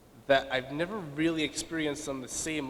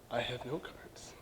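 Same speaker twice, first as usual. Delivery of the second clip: quick whispered confession, much softer and slower; whispered aside; 3 semitones lower, 11 dB quieter than usual. Real, not acted. Speaker at podium admits to audience, whispered aside. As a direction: let it slip out under the breath.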